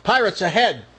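mock sailor's alarm call; lookout's yell. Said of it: "yells to the captain".

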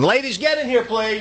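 a high summoning call to the ladies. Real, not acted. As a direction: call them in from the next room, high. Calling colleagues into studio.

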